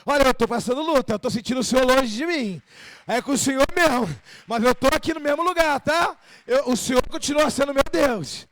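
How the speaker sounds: insistent, full-voiced declaration of faith; earnest protest. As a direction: plead forcefully, standing your ground. Acting a believer's complaint to God.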